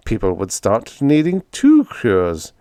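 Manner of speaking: ordinary delivery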